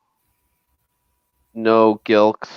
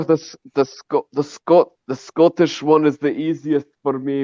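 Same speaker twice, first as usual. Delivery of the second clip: higher-pitched put-on Scottish accent; Fat Bastard; 6 semitones higher than usual. Host showing off the easiest accent, Scottish imitation. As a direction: launch into a broad mock-Scottish accent. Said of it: "imitating Fat Bastard".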